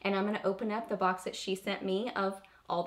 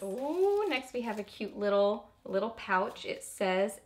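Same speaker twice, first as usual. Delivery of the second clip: ordinary delivery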